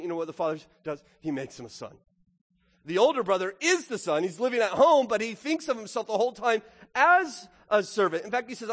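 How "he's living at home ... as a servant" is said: normal talking voice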